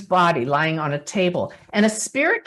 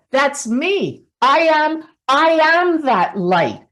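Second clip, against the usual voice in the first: emphatic spirit voice; 6 semitones higher, 4 dB louder than usual. Retold or imitated. Voicing Susan's spirit message.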